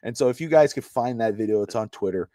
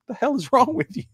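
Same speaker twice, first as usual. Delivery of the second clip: high, startled retort of a confused friend; baffled outburst; 11 semitones higher than usual. Retold or imitated. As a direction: fire it back, thrown by the odd call. Friend reacts to strange call.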